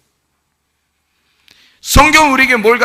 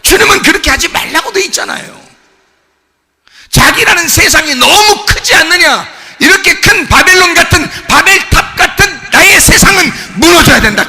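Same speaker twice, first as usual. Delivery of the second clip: high, loud, sing-song preacher's cry; preaching at full force; 9 semitones higher, 8 dB louder than usual. Acted. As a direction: chant the rebuke in rising waves. (높고 크게 외치는 설교조)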